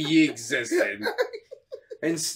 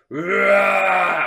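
drawn-out mock Bigfoot bellow; Sasquatch roar; 2 semitones higher than usual. Sasquatch replying to "are you gonna jump", roaring. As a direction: wail out a wordless beast cry.

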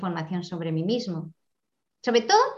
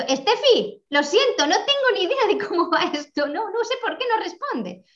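flustered panic; 9 semitones higher than usual. Retold or imitated. Recalling apologising to Steffi in a flustered panic when the interview tech failed. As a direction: replay the moment the tech froze on you. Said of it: "yo me he puesto alterada".

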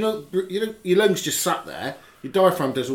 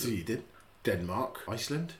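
put-on Swedish accent; 4 semitones lower, 8 dB quieter than usual. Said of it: "Was that an accent?".

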